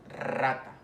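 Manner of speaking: rata with a long rolled R at the start; "rata", drawn-out multiple trill on the R. Shown as a demonstration. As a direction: roll the R hard in rata. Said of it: "la otra es la vibrante".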